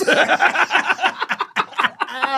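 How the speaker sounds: bright burst of laughter; high laugh. Real, not acted. Laughs at own story.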